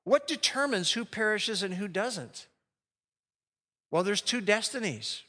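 ordinary talk

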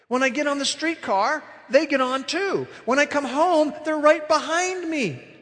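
put-on frightened old lady. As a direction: pour out the fear of being followed.

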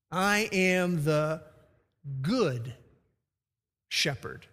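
I am THE... good... shepherd, slow with pauses; drawn out, pausing after "the" and "good"; slow, weighty proclamation; slow stressed THE. Shown as a demonstration. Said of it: "not a good shepherd".

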